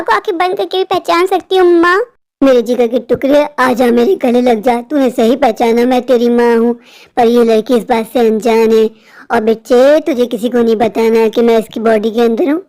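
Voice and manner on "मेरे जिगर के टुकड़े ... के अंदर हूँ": put-on deep ghostly voice, coaxing her child; a dead mother calling her daughter close, low. Ghost mother speaks through body. (गहरी भूतिया माँ की आवाज़)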